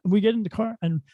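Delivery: ordinary talk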